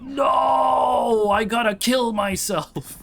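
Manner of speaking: hammed-up drawn-out "no" cry; mock despair. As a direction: wail in exaggerated horror at your own slip. Exaggerated horror at own pronoun slip.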